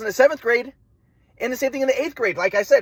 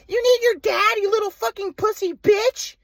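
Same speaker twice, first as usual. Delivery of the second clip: much higher, slow screeching mom caricature; mocking mom voice. Son mimics mom cursing him on the phone, high mocking screech. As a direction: spit the insults high and drawn out like his mom. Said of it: "My mom's like".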